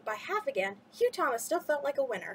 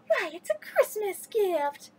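high, slow, thrilled cat voice; the cat delighting at the fish; excited cat; 7 semitones higher, slower than usual. A narrator's reading. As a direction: savour the words like a gift. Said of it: "the cat shouted, excitement swelling her words".

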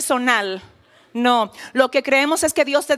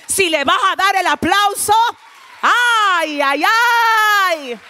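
preaching at full force; 6 semitones higher, slower than usual. Acted. Preacher rousing the congregation to answer, shouting. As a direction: fire up the congregation.

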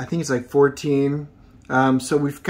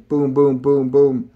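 louder, punchy gunfire noises; gunshot sounds; 4 dB louder than usual. Acted. Reviewer mimics the guy firing his pistol, loud booms. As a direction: bang out each boom like a shot.

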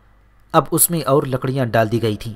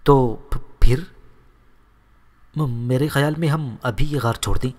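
stammering in fear. A narrator's reading. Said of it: "ہکلاتے ہوئے اس نے پوچھا".

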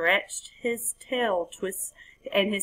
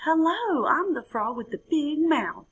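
high, louder frog hello; leaping frog greeting; 9 semitones higher, 4 dB louder than usual. Acted. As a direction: pop out the hello with a little leap. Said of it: "he said with a little leap".

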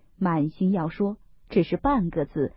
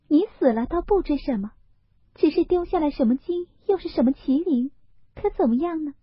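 put-on much higher, fast, sharp-tongued teasing girl; Daiyu teasing; 9 semitones higher, faster than usual. Daiyu to Baoyu, jabbing about his gold, higher and quick. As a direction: needle him about the gold and the qilin.

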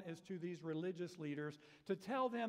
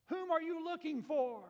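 Jesus calling out; 9 semitones higher, 6 dB louder than usual. Acted. Jesus speaks to the soldiers.

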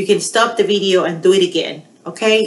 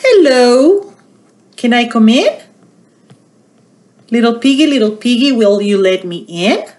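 wolf voice; 3 semitones higher, 5 dB louder than usual. Acted. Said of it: "he knocked on the door and he said".

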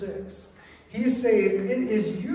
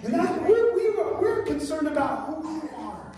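high, loud impassioned preaching; full-force preaching; 11 semitones higher, 4 dB louder than usual. Real, not acted. Pastor telling the congregation come as you are, impassioned preaching.

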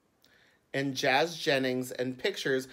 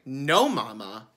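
put-on louder, firm little-girl correction; child correcting mama, louder; insistent child; 10 dB louder, slower than usual. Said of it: "I would say".

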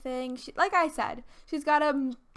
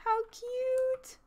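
much higher gushing squeal; cute squeal; 11 semitones higher than usual. Real, not acted. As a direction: melt over something adorable. Squealing at sweet game moment.

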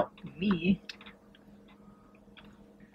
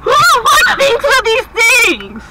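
shrieking, much higher and louder; raging gamer; 14 semitones higher, 8 dB louder, much slower than usual. Real, not acted. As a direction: yell it out, fully fed up. Disgusted outburst quitting the game.

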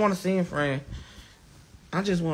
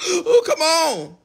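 high, breaking voice, slow and louder; real crying; 13 semitones higher, 7 dB louder, much slower than usual. Real, not acted. Viewers say he's crying, voice breaks into a real cry. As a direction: let the tears break through the words. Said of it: "these folks saying I'm crying".

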